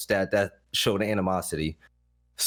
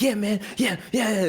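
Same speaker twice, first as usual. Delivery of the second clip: mock heated yeah; 11 semitones higher than usual. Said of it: "He's like".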